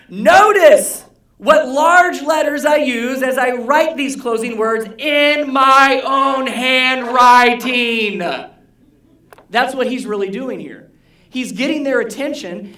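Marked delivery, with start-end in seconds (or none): 0.1-8.2 loud, slow, higher proclamation; booming all-caps reading. Shown as a demonstration. Pastor reads Paul's closing words the way Paul intended, booming. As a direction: read it like Paul writing in huge letters. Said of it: "here's how it really sounds".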